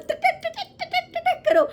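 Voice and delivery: high tapping tak-tak sounds; typing noises. Retold or imitated. Imitating typing on keyboard. (ऊँची आवाज़ में टक टक की नकल)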